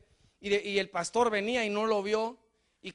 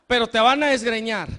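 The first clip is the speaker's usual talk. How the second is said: usual speaking voice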